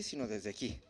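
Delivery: "sino desde aquí" dropped low and soft; from the gut. Shown as a demonstration. Preacher showing words come from the viscera, low and soft. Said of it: "desde las vísceras".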